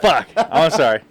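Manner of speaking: loud, laughed-out apology; laughing apology. Real, not acted. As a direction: apologize through real laughter. Apologizing while cracking up at mix-up.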